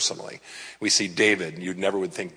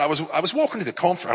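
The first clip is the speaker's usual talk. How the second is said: higher, quick Aussie imitation; Australian accent; 7 semitones higher, much faster than usual. Preacher quoting the conference host, put-on Australian accent. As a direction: mimic an Aussie woman's accent, pitched up. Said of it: "I do a pretty good Australian accent".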